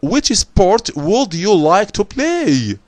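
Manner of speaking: slow, careful textbook reading; slow English reading voice. Acted. Teacher reading the boy's question slowly to the class. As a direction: read the dialogue line slowly for the class.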